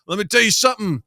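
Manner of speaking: loud, high-pitched Southern bluster; hammed-up Southern showdown, building to a shout; Southern accent. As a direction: play an indignant Southerner making a last stand. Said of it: "do an impression of a Southern accent".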